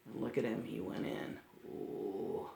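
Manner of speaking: put-on whispered, breathy aside to the kids; hushed aside. Pointing out the sneaky wolf to children, hushed whisper. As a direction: lean in and whisper the secret.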